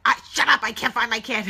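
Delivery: loud, high, panicked snap at a stranger; frantic yelling. Retold or imitated. Mom to man at soccer field while hunting her kid, frantic yell.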